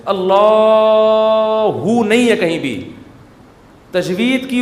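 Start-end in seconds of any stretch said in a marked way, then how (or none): 0.1-2.9 اللہ drawn out slowly with a long vowel; wrongly stretched Allah. Shown as a demonstration. Preacher demonstrating the wrong long vowel in اللہ. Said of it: "یہ اللہ ہی ہے بس".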